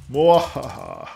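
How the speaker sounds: slow, loud evil villain laugh; mad scientist. Pretend. Villain laugh after rule the world.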